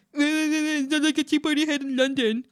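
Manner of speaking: whiny mock voice of a snob; mocking whiner. Mimicking the guest boasting about London, mocking whine. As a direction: parrot them in a silly whine.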